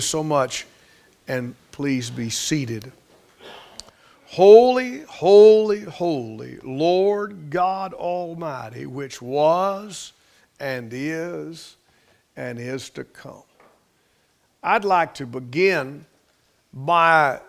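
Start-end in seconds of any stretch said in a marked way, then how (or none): 4.3-13.4 scripture proclamation. Real, not acted. Preacher reciting the sermon's text to seated congregation, solemn proclamation. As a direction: proclaim it, then let it settle slowly.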